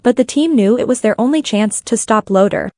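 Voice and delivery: ordinary delivery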